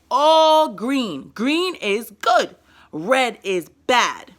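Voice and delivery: put-on slow, loud, simple words to a little girl; slow scolding child talk. Shown as a demonstration. Mother scolding daughter for a red day, slow simple words. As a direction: lay it out slowly like a mom at child level. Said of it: "you gotta talk at their level".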